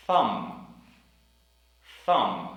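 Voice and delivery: Thumb with a blown th at the start; Thumb, th over tree-like t; careful th in thumb. Shown as a demonstration. Teacher drilling the word Thumb. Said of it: "We also have the word".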